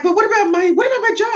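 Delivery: high, pleading mimic of a fretting patient; whiny patient. Patient asks her chiropractor about her jaw, high and whiny. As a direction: whine it like a worried client.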